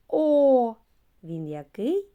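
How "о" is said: loud, long О vowel; sound demo. Shown as a demonstration. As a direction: sound out the О clearly. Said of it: "Звук".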